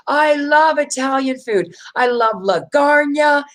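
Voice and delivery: high, drawn-out gushing with "lasagna" as la-GARN-ya; mock food fan. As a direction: imitate a clueless enthusiast, slow and high. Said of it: "So they'll be funny. Like".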